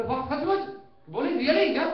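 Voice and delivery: can't believe his luck; amazed. Acted. Traveller amazed that the driver sells common sense, loud and high.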